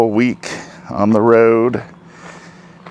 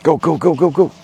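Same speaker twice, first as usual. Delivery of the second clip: yelling from the sidelines; 8 semitones higher than usual. Real, not acted. Cheering running dogs.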